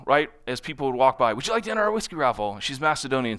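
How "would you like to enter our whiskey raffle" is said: fast, higher sales patter; rapid-fire pitch. Retold or imitated. Natasha calling to passersby, fast and higher. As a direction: rattle it off to people walking by. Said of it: "she can talk really, really fast".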